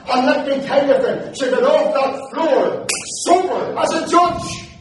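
high, loud gospel preaching; impassioned preaching. Acted. Preacher telling a congregation of a woman's conversion, impassioned preaching. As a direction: drive the testimony home at full voice. Preaches testimony at full force.

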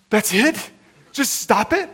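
can't believe that's the answer; incredulous. Retold or imitated. Patient to counselor, stunned disbelief.

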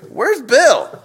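high, indignant questioning; judgmental. Acted. A church volunteer resenting an absent helper, judgmental. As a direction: demand to know where the slacker is. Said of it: "to this kind of judgment".

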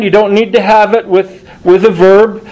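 ordinary talk, nothing marked

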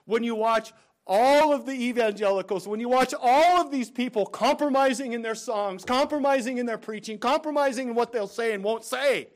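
loud, high, impassioned preaching; fiery preaching. Real, not acted. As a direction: preach it hard at the congregation. Preaching at full force.

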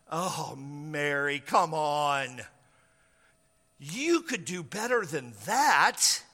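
slow, drawn-out scoffing; unconvinced, chiding come-on; sceptical. Acted. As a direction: drag it out in weary disbelief. Joseph scoffs at Mary's explanation.

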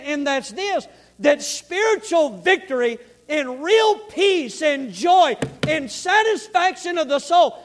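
loud, high-pitched pulpit delivery; full-force preaching. Real, not acted. Preacher urging church toward spiritual victory, full-force preaching.

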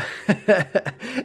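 short amused laugh; a real burst of laughter. Real, not acted. Laughs recalling the long interpretations.

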